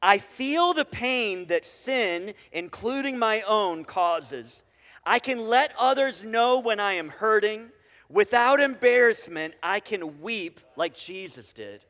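slow, measured congregational reading; reading aloud together. Real, not acted. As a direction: lead the room through it phrase by phrase, slowly. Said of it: "we're gonna read this together".

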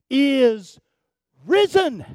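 loud, high proclamation, word by word; exultant preacher's climax; triumphant shout. Real, not acted. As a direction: shout it out to the congregation.

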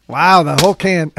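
usual speaking voice

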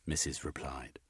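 ordinary delivery

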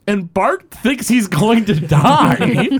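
ordinary delivery

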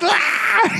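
high laughing yelp of protest; mock cry. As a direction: squeal it out, half laughing. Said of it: "And I'm like".

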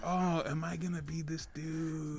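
soft, slow inner worry; half-muttered to himself, slow and soft. Retold or imitated. Thinking in his head, quietly and slowly. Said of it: "in my head, I'm like".

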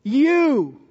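"You." stressed alone as a full stop; emphatic you. Shown as a demonstration. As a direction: point the word "You." at one listener. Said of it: "he focuses in on the individual".

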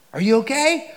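high, loud anxious question; worried wife. Retold or imitated. Wife answering his call after the shooting, high anxious voice. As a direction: ask it like a scared wife.

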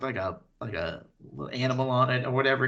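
normal talking voice

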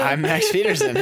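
high, laughing radio host; cheesy host voice. Pretend. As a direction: announce yourself like a corny host. Mock host intro, laughing.

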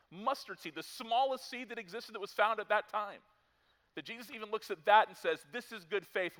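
high, loud, driving pulpit voice; full-force preaching. Acted. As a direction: preach it out with rising fire.

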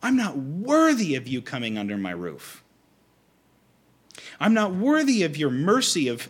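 high, loud, stumbling objection; flustered protest. Acted. Centurion telling Jesus he is not worthy, flustered. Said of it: "He stumbles and he goes".

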